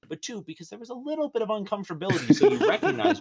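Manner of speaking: laughing through the words; can't hold back laughter while teasing. Real, not acted. Teasing friend about being itchy, laughing.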